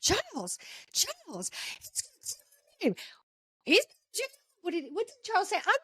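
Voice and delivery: high, strained imitation of a wife pleading with her husband; wife calling back to her husband in a high, soft voice; mock wife yelling back. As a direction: squeak it out in her voice. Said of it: "And Angela's yelling back".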